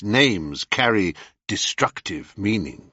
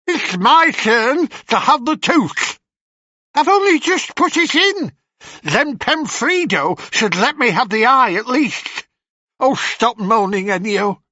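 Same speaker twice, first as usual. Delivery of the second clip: high, loud shrill crone voices quarrelling; bickering hags; 11 semitones higher, 3 dB louder than usual. Acted. Old sisters fighting over the tooth, high bickering crone voices. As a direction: squabble like ancient witches over a tooth.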